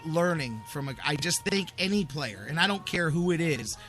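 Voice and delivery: ordinary talk, nothing marked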